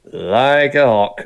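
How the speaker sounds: very slow, pointed remark; drawn out, menacing. Acted. Guard character's warning.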